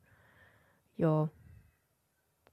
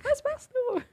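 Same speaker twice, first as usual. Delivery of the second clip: high-pitched Harry voice. Pretend. Acting Harry being pelted.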